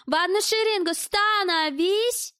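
high, drawn-out parade-ground order; mock drill command. A narrator's reading. Lady lining up fellow hostages, commanding. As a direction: stretch it out like calling troops to line up.

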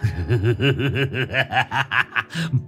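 crazed laughter; mad cackle. A narrator's reading. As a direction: cackle like a madman. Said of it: "Ông cười sằng sặc". (cười sằng sặc điên loạn)

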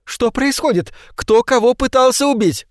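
высоким чужим голосом, тревожно; спроси испуганно; встревоженная Кэс. A narrator's reading. (Кэс вбегает: высокий встревоженный голос)

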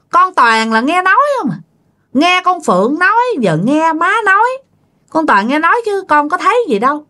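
high-pitched exasperated denial; dismissive. Acted. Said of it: "giống như là bác ra".

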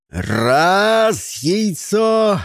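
high, loud count; shouting. A narrator's reading. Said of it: "крикнул Джон".

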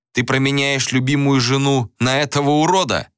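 sneering bandit, rising to a jeer; smirking taunt. A narrator's reading. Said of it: "усмехнулся бандит".

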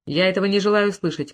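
ordinary delivery

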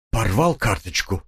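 plain reading voice

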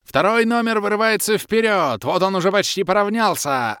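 high, loud race-commentator calls; азартный комментатор. A narrator's reading. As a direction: call the race like a thrilled announcer. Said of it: "всё комментирующая". (Кэлен азартно комментирует гонку, звонко и громко)

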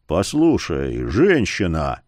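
low, slow male character voice; low, measured warning. A narrator's reading. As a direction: drop the pitch and loom over the listener. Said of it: "Яромир понизил голос".

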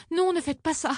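nettement plus aigu, un peu affolé; supplie-les d'arrêter, voix haute; protestation affolée. A narrator's reading. La voisine supplie, paniquée. (protestation affolée, voix aiguë)

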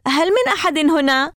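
lifted, ringing question with a rising end; calling out. A narrator's reading. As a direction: call out for anyone inside. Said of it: "وناديت مرة أخرى".

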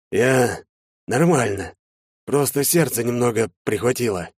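halting, ailing reassurance; struggling to sound all right; pained and weak. A narrator's reading. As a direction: force it out through heart pain. Father having heart trouble speaks haltingly.

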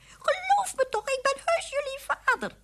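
very high, pleading squeak; squeaky mouse. A narrator's reading. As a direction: plead with your children in a mouse voice. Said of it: "riep hij".

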